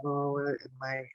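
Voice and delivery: usual speaking voice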